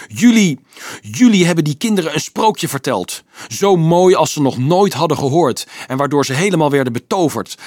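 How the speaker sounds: high, loud furious accusation; enraged tirade. A narrator's reading. Boy raging at priest and leader about false promises, enraged. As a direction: rage at them, out of control. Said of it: "nu helemaal over zijn toeren".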